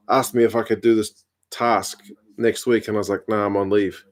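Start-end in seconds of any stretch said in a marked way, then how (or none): none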